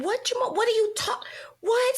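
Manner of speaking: very high, disbelieving retold questions; acted shock. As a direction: relive the shock, pitch way up. Retold shock at tumor news.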